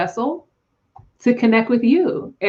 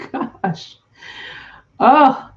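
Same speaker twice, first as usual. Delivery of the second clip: ordinary talk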